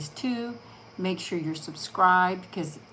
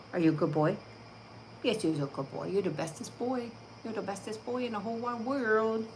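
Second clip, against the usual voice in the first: soft, lilting praise for the dog; 3 semitones higher, 5 dB quieter than usual. Real, not acted. Owner coos praise at her dog, soft baby talk.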